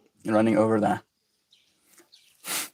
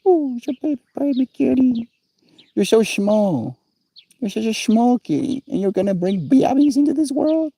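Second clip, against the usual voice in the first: much higher, cooing pet voice; 12 semitones higher than usual. Real, not acted. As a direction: coo sweetly at the tiny kitty. Cooing at small kitty.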